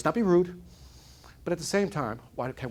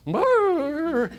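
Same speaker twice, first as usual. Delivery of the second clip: much higher rolling mock growl; dog growl; 13 semitones higher, 5 dB louder than usual. Dog lying on a tack, growling, high rolling brr. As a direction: let the hound whine out a rolling brr. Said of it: "all you hear is what".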